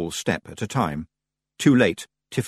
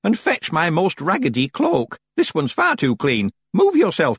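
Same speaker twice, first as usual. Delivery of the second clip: put-on old witch voice; 8 semitones higher, 4 dB louder than usual. Old witch barking orders.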